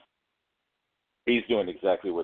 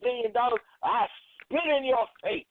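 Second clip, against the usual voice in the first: mock defiance; 13 semitones higher than usual. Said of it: "You think he gonna say".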